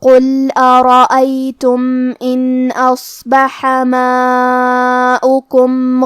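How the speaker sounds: slow, measured tajweed chant; Quran recitation. Acted. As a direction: recite slowly, drawing out every vowel. Recites verses again slowly. (آہستہ تجوید کے ساتھ قرآن کی تلاوت)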